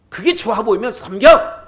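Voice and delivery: loud, high dare; defiant challenge. Retold or imitated. Joshua daring the people to choose, a loud high dare. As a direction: throw down the dare. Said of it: "여호수아가 마지막 유언에 그러는 거예요".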